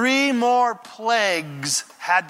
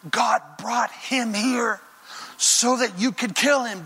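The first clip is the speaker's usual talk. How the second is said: higher, slow, urgent voice of the men in the cave; hiding men urging David; 5 semitones higher than usual. Acted. Mighty men urging David in the cave, urgent. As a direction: egg their leader on, pitched up. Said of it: "And they're going".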